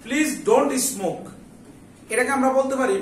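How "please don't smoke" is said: drawn out very slowly, word by word; very slow dictation. Shown as a demonstration. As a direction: dictate it slowly so students can follow. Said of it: "সবাই একটু খেয়াল করো".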